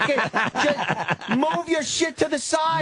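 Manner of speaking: high-pitched, fed-up outburst; comic high-strung fury; exasperated rant. Acted. Acted rant at slow shopper.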